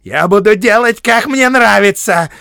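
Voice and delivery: high, loud defiant shout; headstrong, heated refusal; stubborn insistence. A narrator's reading. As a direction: dig in and yell it obstinately. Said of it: "упрямо твердил Бильбо".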